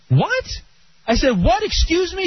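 high, sharp outraged "What? Excuse me?"; incredulous, as if you misheard; indignant disbelief. Retold or imitated. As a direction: caught off guard and offended. Outraged at the tow clerk.

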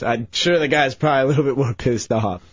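normal talking voice

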